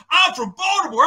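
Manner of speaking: high, loud mock brag; hammed-up poser voice. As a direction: parody a suburbanite claiming the city. Mocking suburban pretenders.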